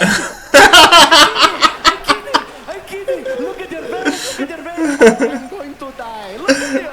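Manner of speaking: loud high teasing through laughter; laughing taunt. Acted. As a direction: tease him while cracking up. Mock-taunting his cousin, laughing.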